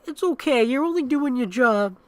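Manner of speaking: put-on a toddler soothing the nurse; imitating child. Boy reassuring Mike, imitated child voice. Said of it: "he's like".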